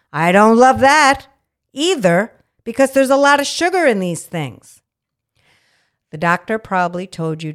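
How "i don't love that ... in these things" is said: emphatic loud. Real, not acted. Emphatic opinion on sugar.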